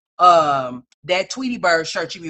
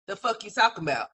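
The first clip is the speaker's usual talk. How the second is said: usual speaking voice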